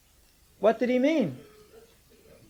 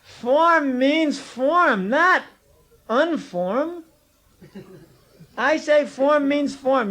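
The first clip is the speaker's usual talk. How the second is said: higher, louder and very slow, hammering each word; pitched up, slow and forceful, like settling an argument; emphatic insistence; 7 semitones higher, 3 dB louder, slower than usual. Real, not acted. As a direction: lay down the law word by word, raised up high. Teacher insisting on a point.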